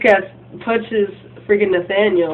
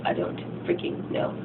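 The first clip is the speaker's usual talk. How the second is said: whispered, giving up; muttered whisper; 11 dB quieter than usual. Real, not acted. Narrator giving up on a name, whispered. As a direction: breathe it out under her breath.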